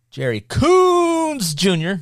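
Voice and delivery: loud, high, hugely drawn-out COONS; hammed-up name call, dragged out and belted; Rob Klepper impression. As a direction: stretch COONS into a long, high held note. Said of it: "That's a horrible impression of Rob Klepper".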